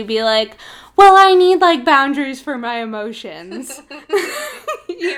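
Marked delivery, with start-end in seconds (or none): 1.0-3.8 hammed-up mock excuse; mock self-righteous. Mocking someone disguising shutting out. 3.8-4.8 high laugh. Real, not acted. Laughing at own parody.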